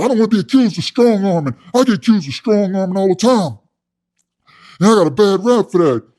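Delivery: high, loud, indignant inmate imitation; loud protest. Inmate protesting strong-arming rap, loud and indignant. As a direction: protest the accusation as the worked-up inmate. Acting out cellmate protesting accusations.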